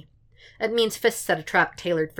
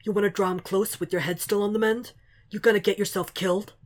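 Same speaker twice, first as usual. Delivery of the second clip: higher, challenging tough guy; gruff Frank voice; 4 semitones higher, faster than usual. Acted. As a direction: press him hard, rough and blunt. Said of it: "Frank's voice echoes".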